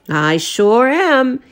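proud and unbothered, taking his time; gruff fisherman. A narrator's reading. Mountain Jim answers the cook, slow and proud.

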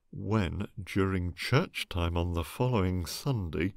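ordinary delivery

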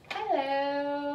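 high, loud, sing-song hello; punchline hello. Acted. George answering mother on the street, sung-out hello. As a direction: draw it out in a put-on voice. Puppy speaks as human in story.

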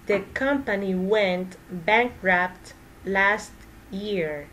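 slow and careful, words spaced out; slow practice sentence; slow repeat. Shown as a demonstration. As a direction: model it slowly for learners. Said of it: "teacher repeats sentence slowly for practice".

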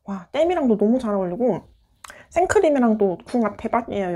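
chewing while talking; speaking around food; mouth full. Real, not acted. Seen 1: Cake goes into mouth at -1; lips pursed, cheeks full while speaking.

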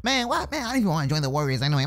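sulky rush. Acted. Sulking after the prank.

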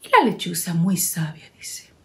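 whispered narration. A narrator's reading. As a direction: hush the line. Narradora susurra. (narración susurrada)

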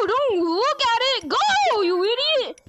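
frantic yelling at a player; shouting. Real, not acted. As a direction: yell it, desperate to win. Urging a player in a race.

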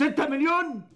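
high-pitched outraged echo; indignant disbelief. Acted. Client balking at paying his wife six million, indignant disbelief. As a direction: throw the figure back, pitch jumping up.

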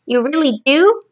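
delighted rising question; brightening. A narrator's reading. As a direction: brighten with hopeful surprise. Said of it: "suddenly brightening".

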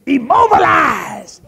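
slow, high, stretched-out shout; dream preaching shout. Retold or imitated. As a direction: bellow each word like a tent revival. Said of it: "And I was saying".

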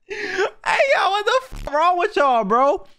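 high, crying-laughing protest; squeaky laughing disbelief at the chat; laughing while talking. Real, not acted. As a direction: get the words out through the laughter. Reacting to anime wallpaper.

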